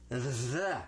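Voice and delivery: the with a long buzzing TH; heavy TH. Shown as a demonstration. Teacher introduces a letter to pupils, heavy TH in the. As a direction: lean hard on the voiced TH in the. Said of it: "this the is a real heavy T H".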